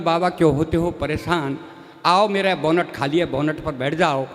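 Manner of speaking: a hint of an easygoing driver; friendly driver. Driver offers bonnet seat.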